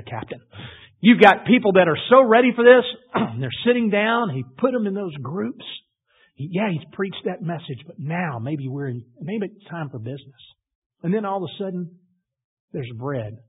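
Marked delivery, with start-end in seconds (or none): none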